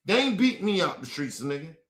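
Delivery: normal talking voice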